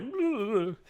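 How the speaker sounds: lightly voiced teasing wife; a mock exasperated "ugh" from his wife; imitating his wife. Husband imitating his wife teasing him about crying. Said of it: "Nell's like".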